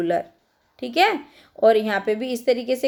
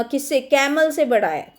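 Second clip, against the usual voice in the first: usual speaking voice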